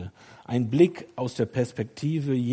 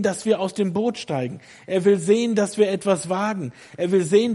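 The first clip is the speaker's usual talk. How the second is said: loud, high, driving sermon delivery; fervent preacher urging the congregation on; impassioned preaching; 5 semitones higher, 6 dB louder than usual. Real, not acted. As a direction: drive each phrase home at full force. Preaches at full force.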